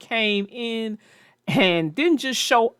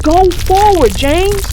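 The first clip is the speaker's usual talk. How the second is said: much higher, louder urgent call; Percy shouting at James to move; 11 semitones higher, 7 dB louder than usual. Acted. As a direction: shout to push him to safety. Urgent warning in landslide.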